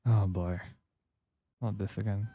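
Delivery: ordinary talk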